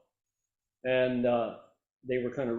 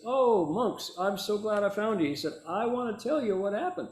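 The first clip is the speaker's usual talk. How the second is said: raised, eager retelling voice; glad greeting; 7 semitones higher than usual. Retold or imitated. Reporter finding the monks, higher-pitched happy greeting. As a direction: imitate someone delighted to find his friends.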